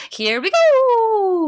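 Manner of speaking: high, loud, slow Mario cheer. Pretend. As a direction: stretch it out like Mario. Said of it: "Like Mario does".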